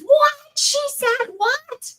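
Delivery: hammed-up double-take; a stretched, incredulous squeal; mock disbelief. Mocking reaction to her perkiness.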